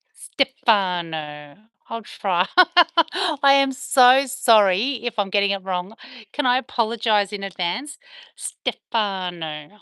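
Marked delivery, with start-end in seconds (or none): 0.1-1.6 "Stefano" drawn out very slowly; careful name attempt. Shown as a demonstration. Streamer tries viewer's name, "Stefano" slow and careful. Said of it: "try and say your name". 8.3-9.8 "Stefano" stretched out slowly; careful name attempt. Shown as a demonstration. Apologetic streamer tries viewer's name, "Stefano" slow, careful. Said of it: "I'm trying my best".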